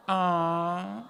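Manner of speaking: ordinary talk, nothing marked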